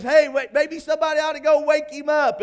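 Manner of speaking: high, loud mocking call; trash talking. Elijah jeering at Baal's prophets, loud trash talk. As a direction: taunt them at the top of your voice. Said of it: "Elijah starts trash talking a little bit".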